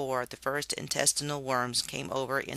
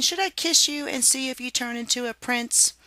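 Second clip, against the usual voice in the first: high, flirty coo; lightly voiced coy lady; playful Harriet; 10 semitones higher, 3 dB louder than usual. A narrator's reading. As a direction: tease the frog sweetly. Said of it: "she said, laughing".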